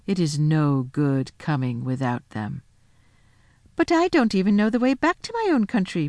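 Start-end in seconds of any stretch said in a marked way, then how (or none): 3.7-6.1 pleading. A narrator's reading. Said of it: "pleaded Edmund".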